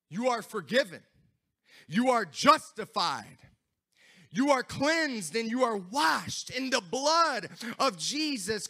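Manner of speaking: loud, emphatic proclamation with punched words; fervent preaching. Acted. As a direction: preach assurance with full force. Preaching at full force.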